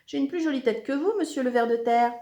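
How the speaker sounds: high, quick, loud comeback; insolent retort. A narrator's reading. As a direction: fire back a cheeky insult. Zekeye réplique par une insulte.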